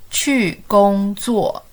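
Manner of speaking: very slow, each syllable held; slow model for repeating. Shown as a demonstration. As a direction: stretch every syllable for learners to copy. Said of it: "Let's say together".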